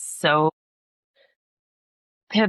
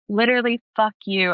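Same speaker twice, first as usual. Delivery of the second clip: higher and a bit louder, a mild mock yell; retold scream at God; 8 semitones higher, 3 dB louder than usual. Alone in her dark bedroom, yelling at the Lord: mild mock yell. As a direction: toss the curse up at God, half-acting it. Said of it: "I just started screaming at the Lord".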